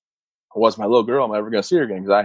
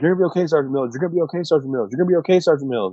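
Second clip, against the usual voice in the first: higher, fast, looping mechanical reassurance; medic stuck on repeat; 6 semitones higher, faster than usual. Retold or imitated. Medic reassuring the wounded sergeant, repeating himself on a loop. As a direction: parrot the same comfort line over and over, pitched up. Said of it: "he kinda got stuck on repeat".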